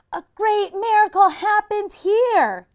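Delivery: shout. Acted. Sadie shouting the right words to stop the pan. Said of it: "Sadie shouted".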